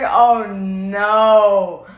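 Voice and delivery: loud wailing Oh no; loud, half-laughing cry of dismay; mock dismay. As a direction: wail it in despair. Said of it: "I was like".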